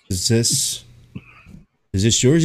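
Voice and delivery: halting, with long pauses; mock slow worker. Zombie worker handing over an order, mock slow. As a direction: drag it out like a dim, slow clerk. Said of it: "they're so slow".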